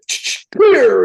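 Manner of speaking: high, loud stuttered "clear"; mock ER shout. Cosmic ER team about to shock him, mock shout. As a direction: call it like a medic before the shock.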